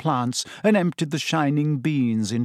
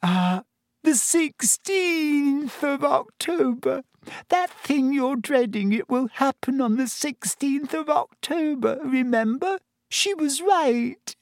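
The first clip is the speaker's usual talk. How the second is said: high, slow, tearful schoolgirl; breaking into a cry at the end; tragic Lavender; 12 semitones higher than usual. A narrator's reading. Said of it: "said Lavender tragically".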